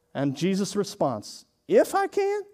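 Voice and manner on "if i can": high-pitched disbelieving "If I can?"; incredulous echo. Acted. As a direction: toss the doubt back, amazed. Jesus answers father's doubt.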